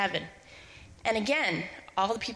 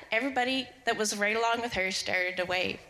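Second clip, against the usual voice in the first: ordinary delivery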